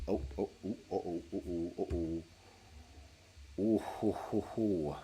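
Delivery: quiet, low hooting noises; soft mock hooting. Streamer testing mic with quiet oh oh hoo sounds. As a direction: hoot softly like testing the mic.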